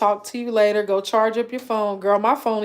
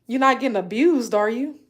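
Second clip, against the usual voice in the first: concerned neighbor; 5 semitones higher, faster than usual. Retold or imitated. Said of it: "She was like".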